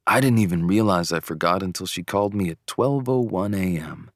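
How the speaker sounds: ordinary delivery, nothing marked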